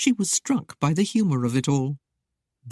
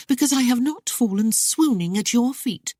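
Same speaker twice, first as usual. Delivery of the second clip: higher, airy teasing; flippant chiding; 8 semitones higher than usual. A narrator's reading. Lady flippantly teasing her suitor, higher and airy. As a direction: toss off the jab lightly. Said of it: "she chided flippantly".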